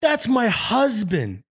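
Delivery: drawn-out smug put-down; girlfriend's reply. Retold or imitated. Girlfriend telling the angry lady, very slow and pointed. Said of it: "my girlfriend goes".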